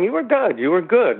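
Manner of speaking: high, eager reassurance; reassuring friend. Retold or imitated. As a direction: pump up a nervous friend. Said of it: "And John's going".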